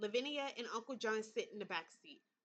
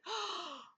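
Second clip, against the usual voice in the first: gasp; 7 semitones higher than usual. Real, not acted. Realizing Thomas sees visions.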